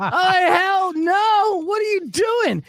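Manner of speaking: high, loud, drawn-out oh hell no; dad yelling at his daughter in high mock outrage; outraged shout.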